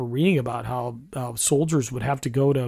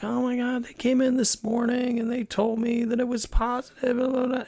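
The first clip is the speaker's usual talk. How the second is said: high, panicky imitation of mom on the phone; panicked mom; 10 semitones higher than usual. Son imitating his frightened mom's phone call, high and panicked. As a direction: pitch way up, rattle it off in a retold panic. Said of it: "She's flipping out".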